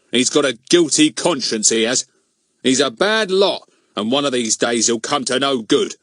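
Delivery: self-righteous grumbling policeman; rude. A narrator's reading. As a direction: rudely brush off the protest. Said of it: "said Mister Goon rudely".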